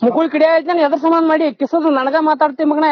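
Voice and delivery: ordinary delivery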